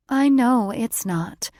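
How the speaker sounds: weary sigh. A narrator's reading. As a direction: let it out on a tired sigh. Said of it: "she said and sighed".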